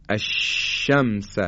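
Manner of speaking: الشمس drawn out slowly, ش hissed wide; slow الشمس with a long open ش; correct shin. Shown as a demonstration. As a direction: spread the air of ش in الشمس. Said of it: "این تلفظ حرف شین هست".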